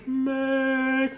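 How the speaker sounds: high, long held note; drawn-out cartoonish cry; Julie's silly voice. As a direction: wail the word way up high. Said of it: "she had a voice like that".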